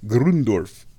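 "Grundorf" loud, with rolled r and oo; relished pronunciation. Shown as a demonstration. Host enjoying a rare word, "Grundorf" said loud with oo. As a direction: savour "Grundorf" loudly as Grroon-dorf. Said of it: "not a word you get to say a lot".

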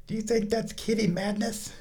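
high cooing voice for the kitten. Real, not acted. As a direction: coo at the cat. Baby talk to the cat.

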